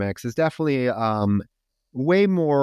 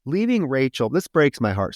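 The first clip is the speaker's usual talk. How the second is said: ordinary talk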